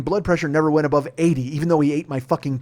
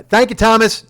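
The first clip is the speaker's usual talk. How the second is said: 1 curt sign-off; 8 semitones higher, 9 dB louder than usual. Retold or imitated. Curtly hanging up on agent.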